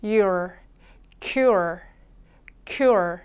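"cure" said slowly as k plus your, the R curled back; 따라 하도록 보여주는 cure; model cure. Shown as a demonstration. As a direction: model "cure" three times for the class. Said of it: "한 번 더".

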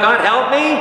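high-pitched plea to God; calling out. Acted. Preacher voicing the congregation's prayer, calling out. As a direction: call out for help, voice lifted high. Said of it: "Doesn't this have you call out".

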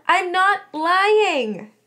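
high, loud, drawn-out denial; furious. A narrator's reading. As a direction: deny it furiously, stretching the words. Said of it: "Melody hissed furiously".

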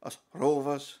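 practising an imitated accent on "Rovers"; lightly imitated heavy accent, word by word; JDT. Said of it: "practice my J D T accent".

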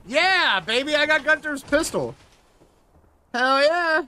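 high, excited cheer; gleeful celebration. Real, not acted. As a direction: crow over a new prize. Celebrates getting a new pistol.

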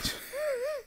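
high whooping cackle; whooping laugh. Retold or imitated. Narrator whoops after flipping off his ex, high cackle. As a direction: let out a wild triumphant whoop. Another whoop mid-sentence.

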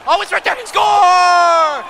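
high-pitched, building to a roar; loud triumphant play-by-play; excited goal shout. Real, not acted. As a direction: spot the chance, then belt the call. Calling own goal in game.

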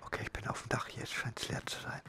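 whispering. Real, not acted. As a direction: whisper it so nobody hears. On roof, sneaking past enemies. (geflüstert)

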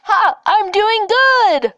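loud, slow triumphant boast; loud gloating over his parkour trouble; gloating shout. Real, not acted. Gloating at friend's struggle.

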